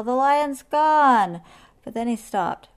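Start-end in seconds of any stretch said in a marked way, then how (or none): 0.0-1.4 high, loud, slow drawn-out cheer; cried out. A narrator's reading. Hornbill celebrates lion's escape, excited cry. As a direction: proclaim it slowly in triumph. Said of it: "cried Hornbill".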